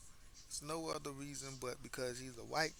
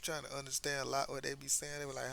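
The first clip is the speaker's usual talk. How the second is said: ordinary talk, nothing marked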